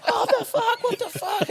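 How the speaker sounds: high-pitched startled what the fuck, a laugh in it; half-asleep lad startled by mates cheering his draft; shocked disbelief. Retold or imitated. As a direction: yelp it like you just woke to chaos. Woken, sees name drafted.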